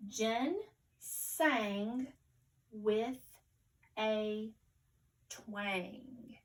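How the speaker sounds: word by word, a clear pause after each; very careful reading. Shown as a demonstration. Teacher reading a new sentence with pupils, very carefully. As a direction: read each word separately, slowly and carefully. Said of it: "We'll read it very carefully first".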